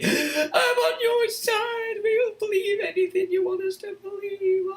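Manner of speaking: hammed-up crying coward's plea; whiny coward. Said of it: "all these cowards on the street going".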